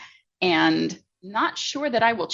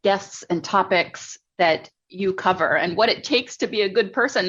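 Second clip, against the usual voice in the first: normal talking voice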